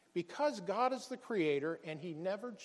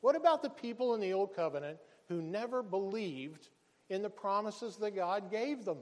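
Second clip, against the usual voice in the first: ordinary talk, nothing marked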